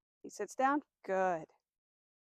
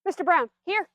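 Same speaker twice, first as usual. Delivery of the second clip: higher, louder call across the yard; 7 semitones higher, 7 dB louder than usual. Shown as a demonstration. Trainer calling her dog from a distance, loud and high. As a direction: call him from across the room.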